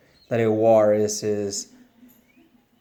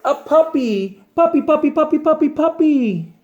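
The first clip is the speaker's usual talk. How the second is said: high, loud squealing pigeon voice; excited pigeon; 6 dB louder than usual. Acted. Pigeon squeals with delight at the thought of a puppy. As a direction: squeal it like a bird who just saw a puppy.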